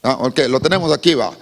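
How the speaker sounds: usual speaking voice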